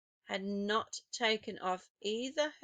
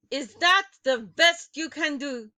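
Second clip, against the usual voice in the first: put-on loud, higher accented taunt; mock their efforts; sneering witch; 5 semitones higher, 10 dB louder than usual. A narrator's reading. Grand High Witch taunting her witches, loud.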